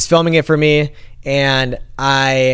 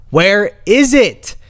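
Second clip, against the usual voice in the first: panicked; 5 semitones higher, much slower than usual. Retold or imitated. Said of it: "So I'm freaking out".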